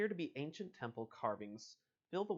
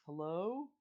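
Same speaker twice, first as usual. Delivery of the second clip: calling out; 8 semitones higher, 5 dB louder than usual. Acted. Calling into a dark house.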